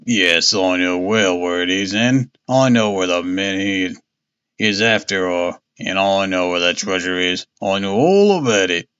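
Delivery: stubborn old seafarer, lightly voiced; old seaman voice. Old seaman figure speaks.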